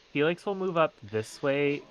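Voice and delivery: usual speaking voice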